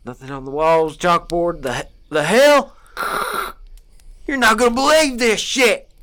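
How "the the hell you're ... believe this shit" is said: surprised, rising to excited. Acted. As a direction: catch your breath, then blurt the discovery. Finding her notes on the chalkboard.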